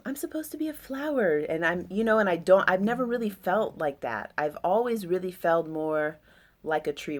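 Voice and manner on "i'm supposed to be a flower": higher and faster, poking fun at the idea; mock girly lilt, rushed; mocking expectation. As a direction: toss it off in a sing-song mock voice. Mocking what's expected of her.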